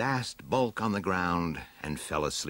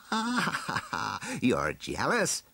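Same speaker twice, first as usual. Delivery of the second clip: high mock laugh falling into slow taunt; gloating villain; 6 semitones higher, slower than usual. Lord of Destruction taunts his cat, high laugh then slow tease. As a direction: tease with a villain's spoken cackle.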